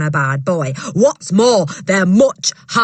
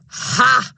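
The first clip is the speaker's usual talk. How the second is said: loud contemptuous ha; scornful snort; 7 semitones higher, 4 dB louder than usual. Acted. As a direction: snort it out in disdain. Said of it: "snorted Miss Trunchbull".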